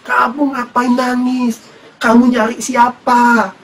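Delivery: high, slowly stretched questioning; questioner at the tomb. Acted. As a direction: put on the stranger's lifted, drawn-out voice. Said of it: "di luar kubur ditanya lagi".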